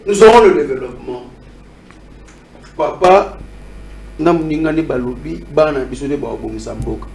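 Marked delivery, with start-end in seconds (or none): none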